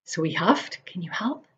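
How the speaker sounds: ordinary delivery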